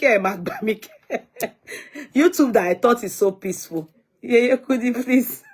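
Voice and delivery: talking through real laughter; laughing. Real, not acted. Teasing her viewers by name, laughing. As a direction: let giggles break up the words.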